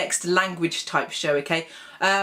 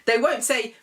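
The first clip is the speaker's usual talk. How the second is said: usual speaking voice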